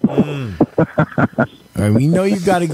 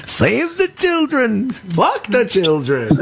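high, drawn-out mock cry; mimicked punchline; 8 semitones higher, slower than usual. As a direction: echo the joke's rabbi, stretching it high then dropping. Host re-enacts the rabbi's punchline.

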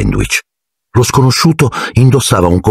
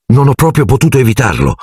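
hurriedly; 8 semitones higher than usual. A narrator's reading. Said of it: "aggiunse in fretta".

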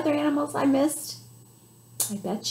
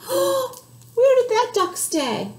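higher, slow worried gasp; surprised gasp; 9 semitones higher, slower than usual. Acted. Librarian to kids, mock alarm at the lost duck.

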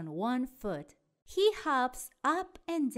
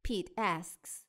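plain reading voice

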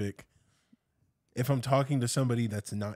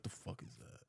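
whispered, much softer aside; baffled undertone; 5 semitones lower, 21 dB quieter than usual. Acted. Outsiders reacting to "hobby games", baffled undertone. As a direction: mutter it under the breath as a puzzled outsider.

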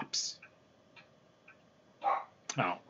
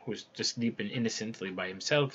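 usual speaking voice

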